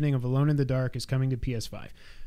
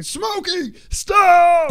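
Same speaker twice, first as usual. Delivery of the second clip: high, loud drawn-out yell at the cat; stretched-out, high-pitched scolding shout; imitating kid yelling; 10 dB louder, much slower than usual. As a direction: mimic a kid hollering at a pet. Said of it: "my kid was just yelling at him".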